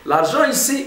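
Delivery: laughing through the words. Real, not acted. Preacher amused at his own point, laughing. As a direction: chuckle as you restate it. Laughs while repeating money.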